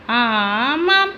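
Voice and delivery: long drawn-out, sarcastic yes; scoffing agreement. Acted. Wife answering her husband's lecture, scoffing agreement. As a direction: stretch it out slowly in mockery.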